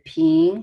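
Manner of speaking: PING rising steadily; second tone. Shown as a demonstration. Teacher naming each tone, rising PING. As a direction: lift the pitch through PING. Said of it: "second tone".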